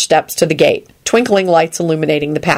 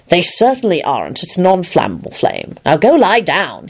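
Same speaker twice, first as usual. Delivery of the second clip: mild firm retort; lightly impatient reply; Lupin, retorting. A narrator's reading. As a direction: answer back with understated insistence. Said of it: "he retorted".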